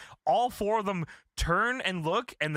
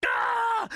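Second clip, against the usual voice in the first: high loud wordless yell of fury; hammed-up scream before flipping a table; mock rage roar; 3 dB louder than usual. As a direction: let out a big angry roar. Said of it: "And Sting goes".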